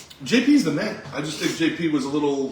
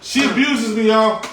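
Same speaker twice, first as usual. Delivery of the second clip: mock complaint; 8 semitones higher, 8 dB louder than usual.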